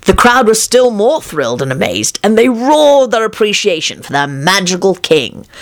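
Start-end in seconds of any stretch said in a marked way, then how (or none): none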